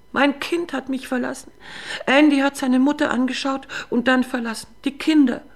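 put-on high, wailing drunken lament; drunken melancholy. Drunk wife wailing to husband that her son left her.

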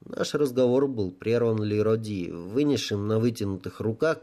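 plain reading voice

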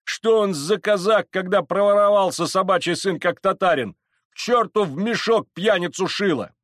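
high, loud angry abuse; forceful, scornful yelling; cursing crowd shout. Acted. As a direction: rail at him over the mob. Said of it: "кричала с бранью толпа".

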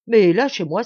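loud outburst to get free; impatient protest. A narrator's reading. Sergeant held back by Martial, impatient protest. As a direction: demand release loudly.

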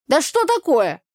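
put-on loud, high folksy village girl; brassy country girl scolding; Tanya's brash voice. Village girl Tanya, loud and brash.

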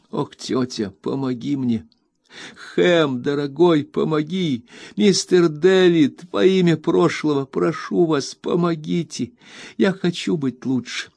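high, tearful begging; Emily begging her aunt, Ham and David; desperate plea. A narrator's reading. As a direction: beg everyone for help, near tears. Emily begs for help.

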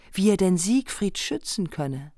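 ordinary talk, nothing marked

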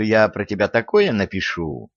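ordinary delivery

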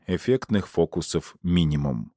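ordinary delivery, nothing marked

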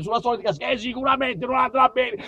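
ordinary talk

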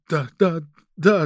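quick stumbling repeated assent; flustered babbling. A narrator's reading. Agent cowed by the chief's shout, babbling assent. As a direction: give in with hurried, repeated agreement. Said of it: "забормотал, кивая Сурков".

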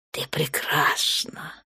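slow soft whisper, no voice; peaceable whisper. A narrator's reading. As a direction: breathe it out gently to calm her. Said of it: "миролюбиво проговорил Торн". (миролюбивый шёпот)

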